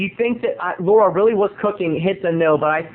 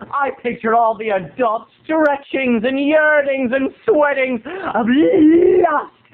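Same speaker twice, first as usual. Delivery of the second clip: high, slow, hammed-up salacious; mock lurid; 7 semitones higher, slower than usual. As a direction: stretch it out leeringly. Lurid fantasy, drawn out.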